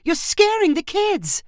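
very high, drawn-out scolding; shouted protest. A narrator's reading. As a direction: protest in alarm, pitch way up. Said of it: "Marissa shouted".

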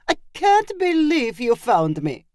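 high, put-on chef voice crying out happily; delighted chef. A narrator's reading. Chef hurrying to Chandra, delighted cry. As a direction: exclaim as a thrilled chef character. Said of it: "the chef cried to Chandra".

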